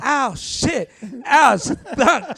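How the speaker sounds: loud, high yelps with impact noise; imitated pain cries. Girlfriend imitating his escalator fall, loud high mock yelps. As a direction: act out tumbling down steps, yelping each bump. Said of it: "You kept going".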